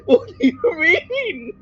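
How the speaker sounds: laughing. Real, not acted. Player laughing in disbelief.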